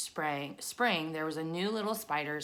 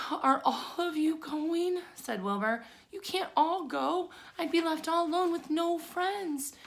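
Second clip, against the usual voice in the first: worried piglet voice; 12 semitones higher than usual. A narrator's reading. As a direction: beg them not to leave you alone. Wilbur pleads, voiced high and slow.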